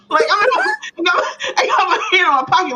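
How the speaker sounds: high, fast, laughing retelling; can barely get it out for laughing; laughing at her own caught-red-handed story; laughing while telling. Real, not acted.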